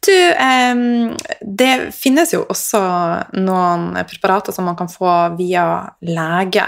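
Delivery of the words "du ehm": ordinary delivery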